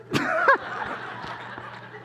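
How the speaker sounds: high burst of laughter. Real, not acted. Punchline laugh.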